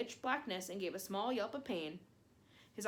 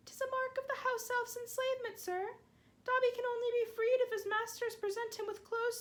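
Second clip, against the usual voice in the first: high, squeaky elf voice, begging; a small elf scolding his hero; pleading house elf; 14 semitones higher than usual. Acted. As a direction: house elf, high and anxious, reproaching Harry.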